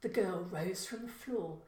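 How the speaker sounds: ordinary delivery, nothing marked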